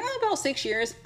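high, offhand fib; breezy pretend answer. Traveler fibbing to worker about teaching, mock casual. Acting out her casual lie.

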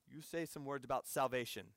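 hushed, lightly imitated Indian accent. Ministry leader quietly instructing the visitor, light Indian accent. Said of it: "That's my Indian accent interpretation".

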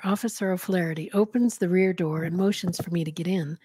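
ordinary delivery

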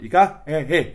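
loud mock Irish accent, half laughing; blurted thick Irish accent; strong Irish accent. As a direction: imitate a barely intelligible Irish accent. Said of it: "his accent was so strong".

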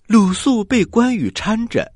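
ordinary delivery, nothing marked

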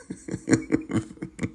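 breathy giggle fading; quiet wheezing laugh. Real, not acted. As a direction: laugh softly to yourself. Final laugh.

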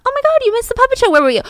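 high, fast, loud gasp of surprise; surprised exclaim. Retold or imitated. Another churchgoer exclaiming to Candy that she missed the show. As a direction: burst out at her, shocked. Someone else voiced exclaiming.

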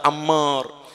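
usual speaking voice

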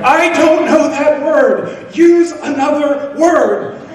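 high, slow, drawn-out shout, half laughing; mock exasperated shout. At the grocery checkout, shouting back at the cashier in hammed-up exasperation. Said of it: "And she'd say it louder".

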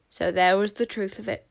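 ordinary delivery

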